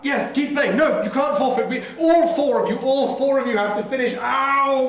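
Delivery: high, loud panicked pleading; frantic begging. Acted. Edmund begs the fleeing players not to go, high panicked pleading. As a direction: plead as if their lives depend on it.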